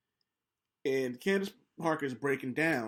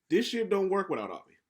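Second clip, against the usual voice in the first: usual speaking voice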